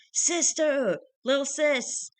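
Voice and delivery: high, loud greeting; excited calling out. A narrator's reading. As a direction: burst out happily greeting a little sister. Excited brothers call out to sister.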